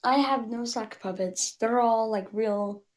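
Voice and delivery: ordinary delivery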